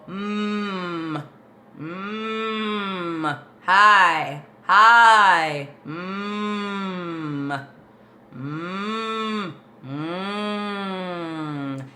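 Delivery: long, steady hums with open held "hi"; humming demo. Shown as a demonstration. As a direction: resonant humming vocal warm-up. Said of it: "if I go".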